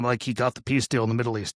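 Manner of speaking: normal talking voice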